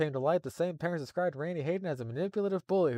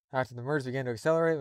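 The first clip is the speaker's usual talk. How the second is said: normal talking voice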